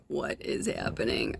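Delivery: soft, unvoiced, amazed whisper; bewildered whisper. Real, not acted. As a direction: breathe the question in disbelief. Stunned as something vanishes.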